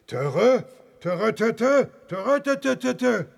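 high, loud trumpeting elephant chatter; asking the elephant about the flight, high trumpeting elephant chatter; elephant talk. Acted. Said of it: "Nando, hat dir der Flug".

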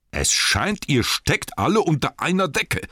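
high, forceful suspicion; angry accusation. A narrator's reading. Thorin sees they are all in league, angry.

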